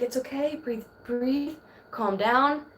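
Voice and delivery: soft, hushed soothing; calm a crying baby, softly. Retold or imitated. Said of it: "and you're like".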